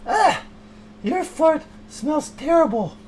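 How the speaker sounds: high, loud cry of disgust, then slow; hammed-up disgust at a fart; disgusted shriek. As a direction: recoil from the smell out loud.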